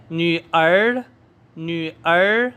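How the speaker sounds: न्यूअर with a rising second tone, drawn out. Shown as a demonstration. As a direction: rise steadily through न्यूअर. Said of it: "आपने second tone के साथ पढ़ना है".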